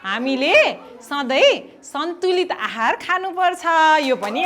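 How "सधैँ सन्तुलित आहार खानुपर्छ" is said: model sentence. Shown as a demonstration. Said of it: "अन्तिममा एउटा वाक्य बनाउँछु".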